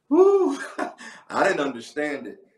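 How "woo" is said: high shouted holler of joy; exultant preacher whoop; ecstatic woo. Real, not acted. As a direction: let out a joyful church holler.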